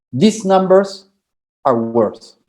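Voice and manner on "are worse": ordinary talk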